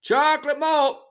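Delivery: very high sing-song vendor call; high ballpark hawker cry; stadium vendor hawking. Retold or imitated.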